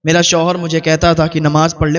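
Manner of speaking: plain reading voice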